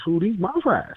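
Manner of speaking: lightly voiced monster growl. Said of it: "and my monster voice".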